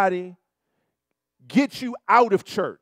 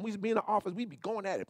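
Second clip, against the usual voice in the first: ordinary delivery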